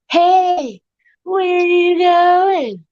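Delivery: high, drawn-out mock calling; patronizing, sarcastic. Second guy calls after Derek walking away, patronizing. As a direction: call after them, stretched high with fake concern. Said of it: "patronizing and sarcastically".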